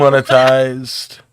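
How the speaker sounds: loud, slow, high groaning protest; a slow, loud cry of exasperation; drawn-out exasperated cry. Real, not acted. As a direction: stretch it out loud in mock despair. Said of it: "watching him getting frustrated".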